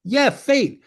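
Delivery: high, mocking echo of fate; mock agreement. Answering the host's fate remark in a high teasing echo.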